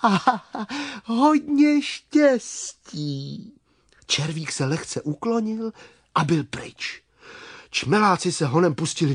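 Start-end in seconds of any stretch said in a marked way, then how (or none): 0.0-3.5 small high voice, slow and drawn out; a tiny creature bidding farewell, high and unhurried; high-pitched storybook worm; little worm. Acted. Worm figure takes his leave.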